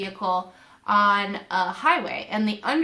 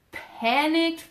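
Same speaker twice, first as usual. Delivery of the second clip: normal talking voice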